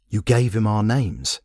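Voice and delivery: ordinary delivery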